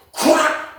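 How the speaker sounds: sharp high whop; imitated punch sound. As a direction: smack the sound out.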